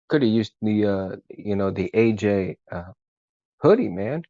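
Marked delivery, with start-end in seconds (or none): none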